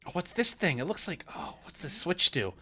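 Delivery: high, curious character voice; excitable kid exploring; giddy newcomer. Acted. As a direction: poke at things with eager wonder. Giddy character fiddles with stove.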